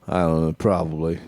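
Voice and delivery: normal talking voice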